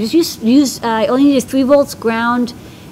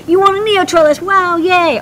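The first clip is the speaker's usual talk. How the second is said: excited; 8 semitones higher, 3 dB louder than usual. Real, not acted. Telling caller he won.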